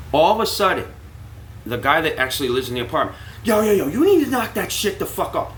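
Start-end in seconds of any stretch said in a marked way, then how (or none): 3.5-5.6 much higher, imitated angry yelling; angry tenant. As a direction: apartment owner yelling at the narrator to shut up, angry. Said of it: "but he let loose on me".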